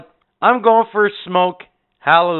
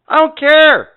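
dismissive shout; 11 semitones higher, 8 dB louder than usual. Retold or imitated. Brushing off celebrity caller.

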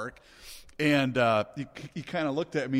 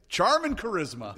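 cheeky retold comeback; 7 semitones higher, 6 dB louder than usual. Retells his cheeky answer to boss.

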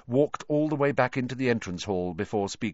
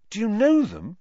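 higher, louder eager question; impressed; 12 semitones higher, 8 dB louder than usual. Acted. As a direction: ask in awe.

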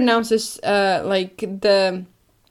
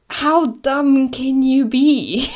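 incredulous; 5 semitones higher, 4 dB louder, much slower than usual. Real, not acted. Incredulous rant.

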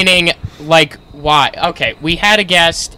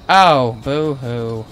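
slow, drawn-out mocking boo-hoo; mock sympathy; much slower than usual. Real, not acted. Jeering at a co-host who avoids drama, mock sympathy. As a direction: draw it out in fake pity. Mocking another's reluctance to explain.

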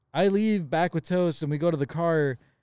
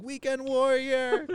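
high, drawn-out mock call; Weekend Warrior; 9 semitones higher, much slower than usual. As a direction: stretch it out in a high voice. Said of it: "watching him going".

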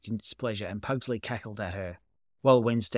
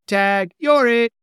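high, loud and slow taunt; playful shouted tag; gleeful cry; 11 semitones higher, 10 dB louder, slower than usual. A narrator's reading. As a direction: yell it at your sister as you shove her. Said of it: "cried Pugsley".

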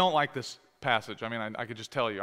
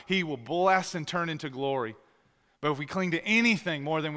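impassioned altar call; 3 semitones higher, 4 dB louder than usual. Acted. Pastor calling listeners to surrender, fervent. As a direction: urge them to give their whole life.

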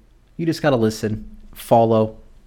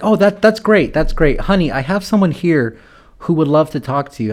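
higher, eager mom voice; imitated mother; 4 semitones higher than usual. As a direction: play a chirpy mother brushing past the complaint. Mother brushing off son's bad day.

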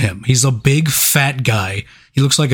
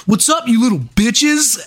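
mock robot bro; 10 semitones higher than usual. Host imitating a cocky breakdancing robot. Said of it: "he just goes".

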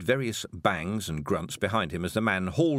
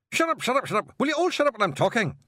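high, loud, fast Scots shout; exasperated Feegle; 5 dB louder, faster than usual. Acted. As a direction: hush the rabble inside the coat. Feegle silencing the others.

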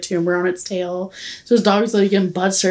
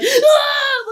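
high-pitched imitated scream; startled yell. Yelling in a lady's face at the aquarium, high mock scream. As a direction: re-enact a startled shriek. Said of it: "I literally yelled in this lady's face".

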